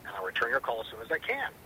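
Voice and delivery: ordinary talk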